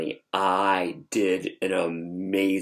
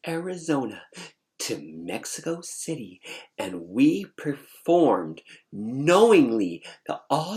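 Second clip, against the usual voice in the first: indignant attitude; 2 semitones higher than usual. Retold or imitated. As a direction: drag out each point with swooping sassy stress. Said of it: "with this attitude".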